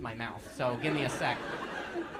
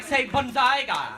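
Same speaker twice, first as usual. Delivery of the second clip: loud, high, slow mock quote; mimicking audience member; 9 semitones higher, 9 dB louder, slower than usual. Comic imitates man's botched word, loud mimicry. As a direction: call it out loud and drawn out, imitating him. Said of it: "He was like".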